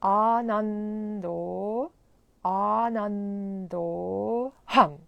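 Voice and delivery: ANANDO very slow, holding the second N before a long O; drawn-out "Anando", lingering on the N. Shown as a demonstration. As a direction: stay on the middle "n" of "Anando", tongue on teeth. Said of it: "stay there a little bit longer".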